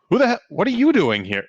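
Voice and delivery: high, startled challenge; startled shout. Acted. Startled worker confronting a stranger, sharp and high. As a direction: jump up and demand answers.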